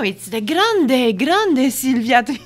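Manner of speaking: high, loud, slow showy English hype; excited mock announcer. Imagined volunteer hyping her arrival, loud and high. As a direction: announce it like a big star.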